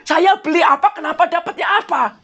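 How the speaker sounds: mock angry customer on the phone; imitating angry customer. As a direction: act out the angry buyer. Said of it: "marah-marah orangnya".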